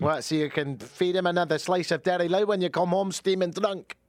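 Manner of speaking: hammed-up indignant accent, rising pitch; scolding partner. Partner accusing Taylor of drinking, indignant accent. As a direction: throw it back in a high put-on accent.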